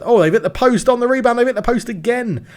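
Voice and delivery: sudden high loud cry at the near miss; surprised. Real, not acted. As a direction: shout it like you can't believe it. Shot hits the post.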